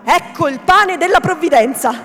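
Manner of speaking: high, loud proclamation; triumphant shout. A narrator's reading. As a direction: cry it out to the whole tavern. (grido trionfante, forte e acuto)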